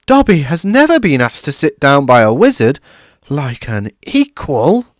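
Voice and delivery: high, loud elf voice slowing at the end; overwhelmed house elf; tearful high elf; moved elf. A narrator's reading. Dobby moved.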